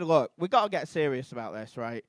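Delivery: ordinary talk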